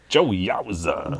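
"Joe Yowza" stretched slow with swooping high pitch; drawn-out exaggerated name. Shown as a demonstration. Host relishing an alien singer's name, "Joe Yowza" slow and swooping. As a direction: croon "Joe Yowza", each part drawn right out. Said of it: "I wanna say it like that".

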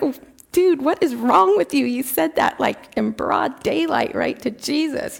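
high, incredulous scolding; mock-aghast, pitched well up; appalled disbelief. Embarrassed believer scolding the father, high-pitched disbelief.

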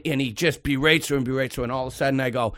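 ordinary talk, nothing marked

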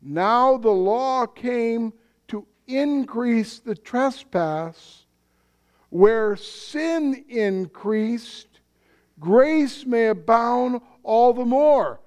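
each phrase slow and weighed, lifting at the end; the preacher reads Paul's words slowly to the congregation; slow scripture reading. Retold or imitated. Slow, deliberate reading. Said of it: "where Paul says".

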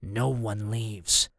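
low, slow command; low, drawn-out menace; villain's order. A narrator's reading. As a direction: lay it down slowly and darkly. Villain orders his henchman to guard.

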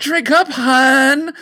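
high, sugary drink up hun; hammed-up doting spouse; mock sweet wife.

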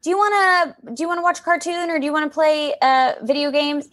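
a hint of her husband asking, lightly teasing; imitating husband. Quoting her husband's question.

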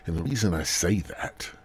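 ordinary talk

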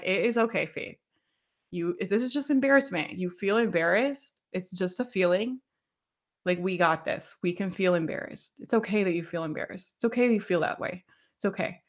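soft, gentle reassurance to herself; soothing self-talk. Retold or imitated. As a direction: talk yourself down softly. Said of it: "I literally go in my brain. I'm like".

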